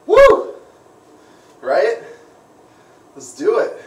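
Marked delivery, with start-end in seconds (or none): 0.1-0.5 loud, high whoop of joy; excited cheer. Real, not acted. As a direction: let out a big celebratory woo. Celebrating booking his flight.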